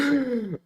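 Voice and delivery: a quick high burst of laughter; short laugh. Real, not acted. Streamer laughing at chat's sniping joke, a short high laugh.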